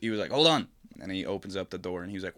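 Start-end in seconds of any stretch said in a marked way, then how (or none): 0.3-0.7 called out. Retold or imitated. Neighbor over the Ring.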